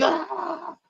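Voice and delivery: very high, hushed mock gasp; mock awe. Storyteller playing everybody in church as the prophet speaks, mock-amazed. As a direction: imitate a crowd swooning in amazement.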